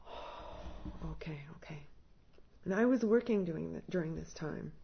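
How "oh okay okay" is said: whispered okay. Retold or imitated. Meekly answering the therapist.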